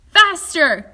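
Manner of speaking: loud, high call; urging the elevator on; shouted command; urgent cry. A narrator's reading. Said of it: "cried Mister Wonka".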